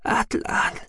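whispered name; a faint breathy whisper. A narrator's reading. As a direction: whisper his name weakly. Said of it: "flüsterte Sahira".

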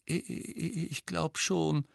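small, high stammering voice; shaky. A narrator's reading. Girl coming round after fainting, shaky. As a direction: stammer it softly, very unsure. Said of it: "erwiderte sie zittrig".

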